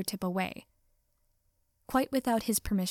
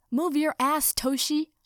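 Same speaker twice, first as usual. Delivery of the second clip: high, loud, barked order; urgent yell; 6 semitones higher, 5 dB louder, slower than usual. A narrator's reading. Katsuki orders his friend to move, urgent yell. Katsuki yelling at Hitoshi in battle.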